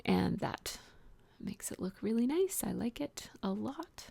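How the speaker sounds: soft, unvoiced admiring whisper. Real, not acted. Crafter admiring her finished feather outline, whispering. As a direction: hush it like a pleased secret.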